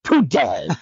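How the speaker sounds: mock wifely order; imitated bossy wife. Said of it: "She goes".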